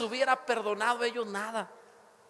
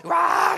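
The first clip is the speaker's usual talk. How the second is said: sudden high yelled ah; imitated shout; 9 semitones higher, 10 dB louder than usual. Imitating a driver yelling at a car, loud yell. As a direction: mimic a startled driver screaming. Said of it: "Gritan así".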